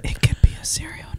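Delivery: breathy whisper. Real, not acted. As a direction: hush it. Whispering after label reading.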